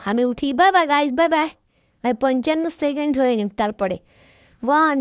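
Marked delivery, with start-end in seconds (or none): 4.6-5.0 building countdown. Real, not acted. Gamer counting down to the finish, building to a shout. Counting down before the final climb.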